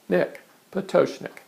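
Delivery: ordinary talk, nothing marked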